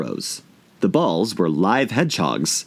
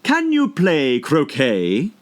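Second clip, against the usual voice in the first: imperious queen; 7 semitones higher, much slower than usual. A narrator's reading. Queen questions Alice.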